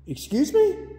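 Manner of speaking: sudden high, incredulous excuse me; shocked, voice jumps up; startled. Real, not acted. Reacts to a spirit answer.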